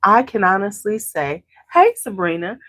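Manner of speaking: normal talking voice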